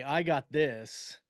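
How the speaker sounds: normal talking voice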